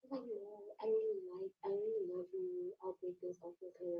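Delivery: high, soft fake-tearful pleading; hammed-up imitation of a weepy boyfriend; mock crying boyfriend. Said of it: "He fake tears".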